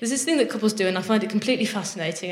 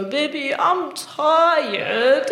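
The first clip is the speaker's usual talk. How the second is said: put-on higher-pitched babyish voice, tired drawn out; baby talk; 5 semitones higher, much slower than usual. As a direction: act like a sleepy toddler. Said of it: "Baby talk".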